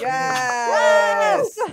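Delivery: a loud, high, cheering yes; excited shout. Real, not acted. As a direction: cheer their friend into the call. Greeting Lupin's arrival.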